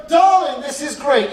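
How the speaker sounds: high, loud mock-doting parent; gushing parent. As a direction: gush over your child's work. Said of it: "they're going".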